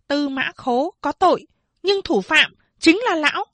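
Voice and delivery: put-on Phán Đệ cursing the blind old man before the crowd; shrewish cursing. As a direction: rail at him like a foul-mouthed country woman. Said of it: "chửi bới như một mụ nhà quê đanh đá".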